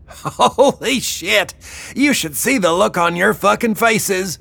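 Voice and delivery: gleeful bellow. Acted. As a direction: crow at the prank in a high voice. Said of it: "she bellowed".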